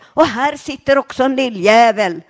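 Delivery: loud screaming rant; screaming stepfather. Retold or imitated. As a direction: scream it like a raving drunk. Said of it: "skriker han och så säger".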